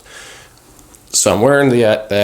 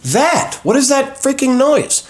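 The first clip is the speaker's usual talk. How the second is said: much higher, louder panicked demand; alarmed shout; 5 dB louder than usual. Retold or imitated. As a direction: yell at the nurse in disbelief. Alarmed at strange noise to nurse.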